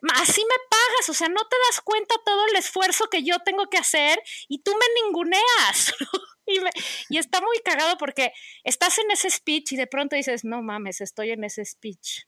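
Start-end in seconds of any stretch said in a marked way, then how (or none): 0.0-5.9 gasping soap opera drama. Retold or imitated. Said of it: "casi como de telenovela". 10.4-12.2 quiet self-aside. Retold or imitated. Catching herself mid-rant.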